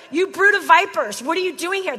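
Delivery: preacher hamming up John's rant, loud; mock cussing. John cussing at the priests at the river, loud mock rant. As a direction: throw it at them like a fiery insult. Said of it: "just starts just cussing at people".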